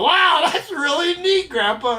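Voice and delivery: put-on squeaky kid marvelling at grandpa; impressed grandson. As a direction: gush like an amazed little boy. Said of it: "And you go".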